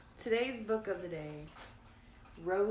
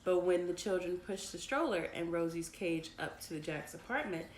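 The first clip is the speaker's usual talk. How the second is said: usual speaking voice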